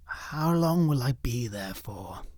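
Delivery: small, slow and quiet; a ghost's small, defeated question. Acted. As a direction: ask it softly, shrinking away. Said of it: "she asked in a quiet voice".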